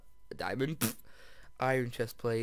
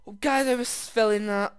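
much louder, higher gasp-and-blurt after a near miss; startled; 9 semitones higher, 10 dB louder than usual. Real, not acted. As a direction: blurt it out right after the fright. Nearly fell in lava.

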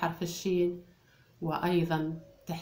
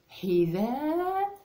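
very slow, much higher حذاء; drawn-out word; 9 semitones higher, 4 dB louder, much slower than usual. Shown as a demonstration. Teacher presenting the word to the class, drawn out. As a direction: stretch حذاء high and long. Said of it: "teacher sounds out the word".

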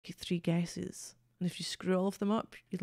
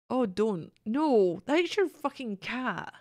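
emphatic; 3 semitones higher, 4 dB louder, much slower than usual. Real, not acted. Mocking friend's cat-lover joke.

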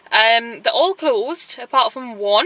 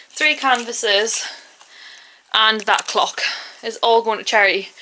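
ordinary talk, nothing marked

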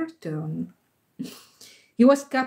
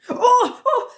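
high, loud "Oh, oh!"; excited exclamation; 13 semitones higher, 5 dB louder than usual. A narrator's reading. Second boy sees the flowers spoil, high excited cry.

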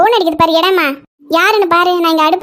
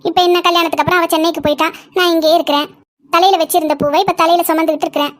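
ordinary delivery

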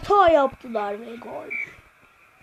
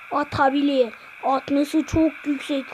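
usual speaking voice

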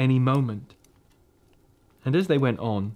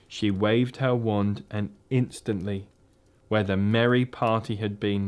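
ordinary delivery

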